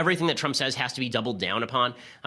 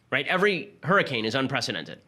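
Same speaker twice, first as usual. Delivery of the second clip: ordinary delivery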